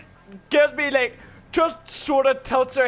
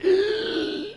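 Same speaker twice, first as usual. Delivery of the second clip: gasp; 10 semitones higher than usual. Retold or imitated. Winded after being shot.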